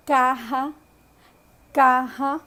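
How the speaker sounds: carra with a rough throat h, like kaha; Spanish caja. Shown as a demonstration. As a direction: say the Spanish word for box, kaha. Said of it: "A palavra caixa é carra".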